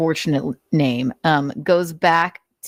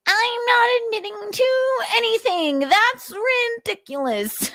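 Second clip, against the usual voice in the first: very high, squeaky mock voice; Nick impression. Narrator voicing Nick refusing to admit anything, squeaky. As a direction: whine it in a squeaky sneer. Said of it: "Nick's impression".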